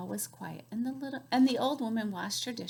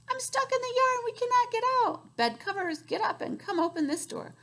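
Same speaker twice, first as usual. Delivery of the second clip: high squeaky fairies; 11 semitones higher, 4 dB louder than usual. Acted. Fairies and objects high voices.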